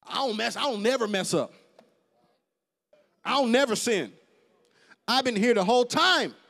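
preacher voicing the proud elder brother, boastful; self-righteous brother. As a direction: puff up like the son who never sinned. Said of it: "This one out there thinks he just the best thing".

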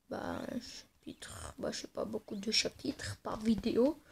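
hushed whispered aside; whispering. Real, not acted. Gamer whispers to himself about the chapters, whispering. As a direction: drop to a breathy whisper to himself.

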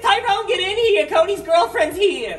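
very high, loud excited yell; over-the-top high-pitched announcement; excited shouting. Acted. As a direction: holler the big news through the house. Acted surprise at the door.

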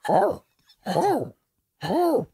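high-pitched imitated barks, spaced apart; small, high mimicked dog woofs. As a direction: yelp like a dog in the background. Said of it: "you hear a dog barking".

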